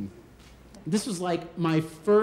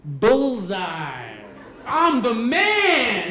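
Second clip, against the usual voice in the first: gleeful triumph; 3 semitones higher, much slower than usual. Retold or imitated. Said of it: "and I'm thinking".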